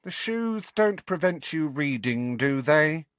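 curt, impatient witch; irritable. A narrator's reading. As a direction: snap it at the time-waster. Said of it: "said the blonde witch, irritably".

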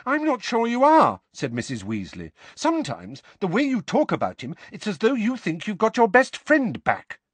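high stern motherly voice; stern Mrs Weasley. A narrator's reading. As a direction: lay down the law like a mother.

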